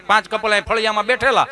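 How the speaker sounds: normal talking voice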